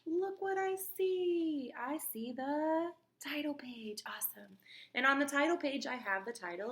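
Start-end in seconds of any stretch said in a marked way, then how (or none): 0.1-1.7 amazed gasp. Acted. Teacher shows book excitedly. 1.8-4.6 put-on hushed whisper for the kids; soft storytime whisper. Teacher whispers to class.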